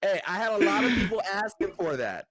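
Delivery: laughing. Real, not acted. Laughing reply to the joke.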